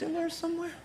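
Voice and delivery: mock high question. Comic jokes about being an apple.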